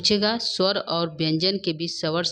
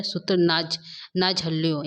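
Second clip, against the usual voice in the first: normal talking voice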